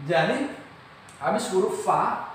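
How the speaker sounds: normal talking voice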